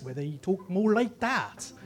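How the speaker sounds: slow Southwest English burr; Southwest English accent. Shown as a demonstration. Speaker demonstrates regional talk to an audience, slow Southwest English accent. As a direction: stretch it out in a West Country accent. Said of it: "in the Southwest".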